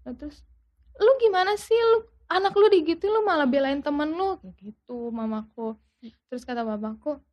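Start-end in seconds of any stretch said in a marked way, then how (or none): 1.0-4.4 high-pitched imitation of a quarrelling mother; mock outrage, pitched up; retold indignant mother. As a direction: voice her exasperated reproach. Retold mother arguing with father.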